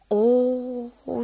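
long, drawn-out ooh; admiring exclamation. A narrator's reading. Madame Dehan exclaims as Macaré gets up, admiring. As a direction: let the oh swell out slowly. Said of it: "s'écria madame Dehan".

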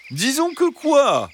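high, slow prodding fox voice; insisting fox. Acted. Fox pushing Bernadette to finish, high and insistent. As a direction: press the hen politely as the fox. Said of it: "insista le renard".

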